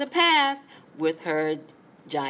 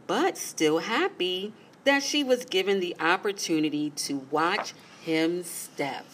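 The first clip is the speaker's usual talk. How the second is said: plain reading voice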